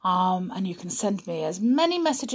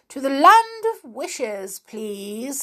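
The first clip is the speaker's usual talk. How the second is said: self-important, louder announcing; 6 semitones higher, 4 dB louder, slower than usual. Acted. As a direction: announce it grandly to the chair. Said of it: "in a rather important voice".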